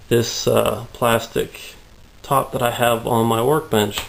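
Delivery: ordinary delivery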